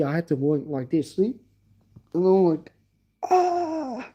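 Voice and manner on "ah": pained cry. Retold or imitated. Neck stuck in pain.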